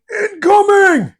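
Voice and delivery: high, slow shouted warning; hammed-up shout of alarm; mock alarm shout. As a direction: yell it like shells are landing. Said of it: "their brain's going".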